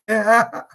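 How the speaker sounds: a bright burst of laughter; short laugh. Real, not acted. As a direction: laugh out loud, amused. Amused by the brother's joke.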